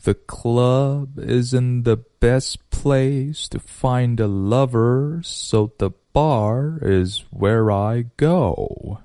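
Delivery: very slow, every word drawn out; 慢速示范. Shown as a demonstration. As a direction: take it at half speed, articulating each sound. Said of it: "听一遍慢速的". (放慢一半，把每个音咬准)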